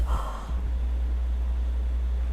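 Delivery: sharp breathless gasp of alarm; shocked gasp. Retold or imitated. Patient on the scanner table, startled gasp. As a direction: caught off guard by a sudden warmth. Realizing she may have wet herself.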